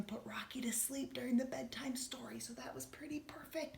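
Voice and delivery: soft whisper so no one wakes. Real, not acted. Teacher whispering because a child fell asleep, whispering. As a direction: whisper it, don't wake him. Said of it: "someone asleep nearby".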